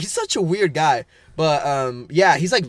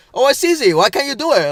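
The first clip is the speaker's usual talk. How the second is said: mocking Mexican boss; 9 semitones higher, 6 dB louder than usual. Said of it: "he was telling us".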